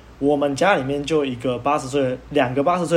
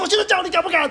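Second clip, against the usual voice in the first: very high, louder taunting yell; retold angry taunt, very high; angry dare; 4 dB louder, faster than usual. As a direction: dare him to his face. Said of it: "反正我就暴怒".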